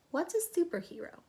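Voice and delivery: usual speaking voice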